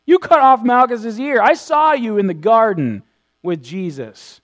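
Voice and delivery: loud, high opening that eases off toward the end; acted eyewitness calling him out; loud accusation. Acting out relative accusing Peter.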